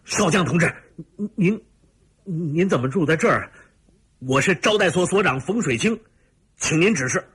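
high, startled officer reporting; flustered, high-pitched deference to a superior; military salute report. A narrator's reading. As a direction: snap to attention and report to a general. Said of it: "啪的一个立正敬礼道".

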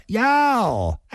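high, drawn-out exclamation breaking into a laugh; suddenly smiling. A narrator's reading. Fat woman suddenly turns to laughing before her rival, amused. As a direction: swoop up into mock delight. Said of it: "突然又笑了".

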